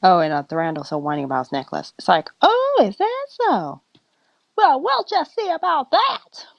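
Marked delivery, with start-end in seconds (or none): 2.4-6.5 harsh talking-to. Acted. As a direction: play an offended mother about to tell someone off. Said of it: "she gave him a very harsh talk".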